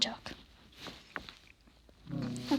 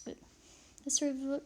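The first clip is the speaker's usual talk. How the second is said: normal talking voice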